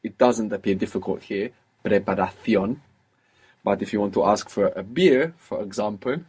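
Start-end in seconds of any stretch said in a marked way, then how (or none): 1.8-2.8 preparación with th for the c; Castilian th in preparación; th pronunciation from Spain. Shown as a demonstration. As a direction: say preparación ending in "thyon". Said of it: "you can make this th".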